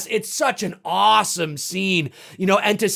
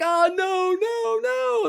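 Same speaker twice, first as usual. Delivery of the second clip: mock terrified; 13 semitones higher, slower than usual. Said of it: "It's like".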